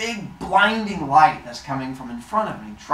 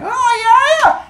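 very high, loud gleeful squeal; hammed-up burst of delight; excited; 12 dB louder, slower than usual. Phaethon promised any wish by his dad, wildly excited. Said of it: "Phaethon is excited".

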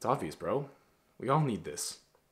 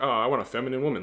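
higher, louder and quick mocking whine; whiny weak guy; 4 semitones higher, 6 dB louder, faster than usual. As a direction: parody a needy guy begging. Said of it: "they're feminine, they're like".

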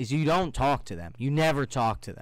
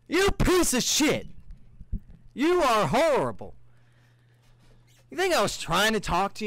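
angry outburst; 8 semitones higher, 4 dB louder than usual. Real, not acted. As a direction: let him have it, cursing the guy. Ranting at the rude guy.

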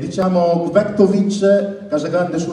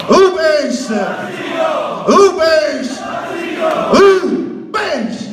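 stadium announcer goal rhyme, then shouting; high, slow, shouted goal call; breathless italian goal commentary; 6 semitones higher, slower than usual. Shown as a demonstration. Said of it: "Il verso di quando segnò".

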